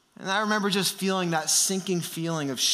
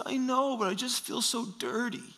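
small, higher, softer upset voice; imitated tearful child; 5 semitones higher, 5 dB quieter than usual. Son confessing his shame to dad, imitated tearful voice. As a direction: play a little boy near tears, hushed. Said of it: "I hear him crying again in his room".

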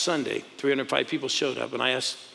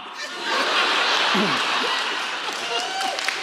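big laugh. Real, not acted. Crowd laughs at the punchline.

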